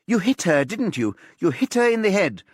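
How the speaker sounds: loud, high accusing push; pressing accusation. A narrator's reading. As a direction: hammer the accusation at her.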